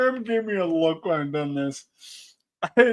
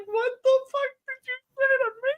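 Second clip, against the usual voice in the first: much higher put-on grandma voice; mock outraged grandma; faster than usual. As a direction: squeak it out like an offended old gram. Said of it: "She's like".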